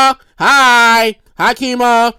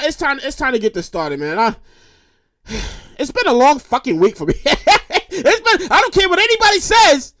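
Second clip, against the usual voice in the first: ordinary talk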